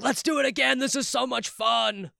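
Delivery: high-pitched, gleeful acted-out cheer; giddy excitement. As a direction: act like a director bubbling over after a take. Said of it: "I'm excited".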